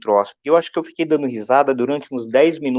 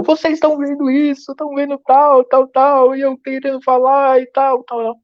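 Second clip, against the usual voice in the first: put-on much higher, louder little-boy voice; toddler son; 5 dB louder than usual. Three-year-old son scolding the family for not listening, high child voice. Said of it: "Porque ele falou".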